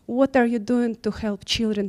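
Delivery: ordinary talk, nothing marked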